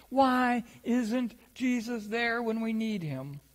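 ordinary talk

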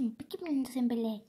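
usual speaking voice

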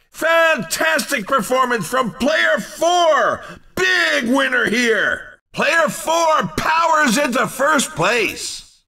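excited sports-announcer praise; excited winner call. Acted. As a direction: hail a big win. Announcer calls the race winner.

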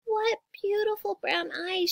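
put-on high, slow girlish admiration; little sister. A narrator's reading. Sister holding the puppy, high admiring voice. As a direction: admire it in a small child's voice.

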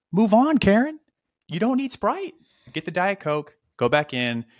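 a hammed-up, fed-up telling-off of the resident; exasperated mock scold. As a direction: shout it at her as if she can hear you.

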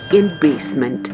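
ordinary talk, nothing marked

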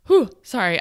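a loud whew of breath, then a shaken sorry; emotional exhale. Real, not acted. Podcaster catching herself getting emotional with listeners, a loud shaky whew. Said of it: "I know I'm getting emotional".

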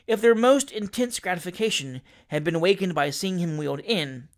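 plain reading voice

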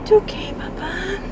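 soft, high, airy pet voice; soothing coo. Real, not acted. Owner calming her crying dog Papa, soothing coo.

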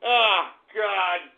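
normal talking voice

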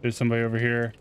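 ordinary talk, nothing marked

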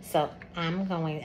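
normal talking voice

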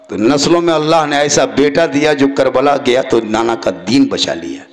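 ordinary talk

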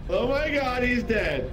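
high, loud hammed-up alarm; exaggerated game-death reaction; mock shock. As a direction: cry out in pretend horror.

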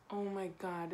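ordinary delivery

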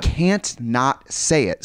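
ordinary talk, nothing marked

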